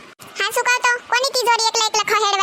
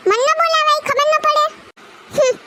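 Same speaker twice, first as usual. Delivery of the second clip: scolding mother; 6 semitones higher than usual. Acted. Mother-in-law scolding son.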